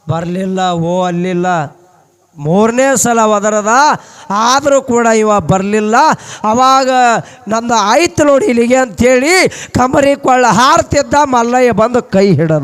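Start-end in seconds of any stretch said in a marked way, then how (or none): 2.4-12.6 louder, higher dramatic telling; sermon climax, rising and loud; building climax. A narrator's reading. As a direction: drive the story to its peak. Climax of the leap.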